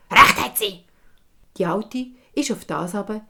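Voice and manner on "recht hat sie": curt hag. Acted. Hag replies.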